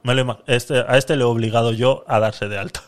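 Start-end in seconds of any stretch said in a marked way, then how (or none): none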